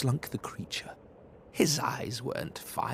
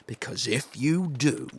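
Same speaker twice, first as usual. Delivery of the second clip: usual speaking voice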